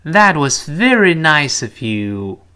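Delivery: slow teacher demo, stretched words; slow model reading. Shown as a demonstration. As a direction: lead the class slowly through it. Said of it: "跟老师慢慢读一遍". (慢速领读示范，拉长音节)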